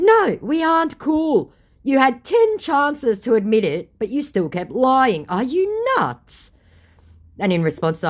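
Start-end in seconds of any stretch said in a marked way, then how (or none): 0.0-6.5 slow, high, emphatic telling-off; indignant retort. Retold or imitated. Vlogger reenacts scolding the scammer, slow and high. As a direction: spell out each word to a liar. Retelling her angry reply.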